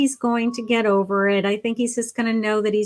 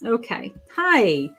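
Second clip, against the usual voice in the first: ordinary delivery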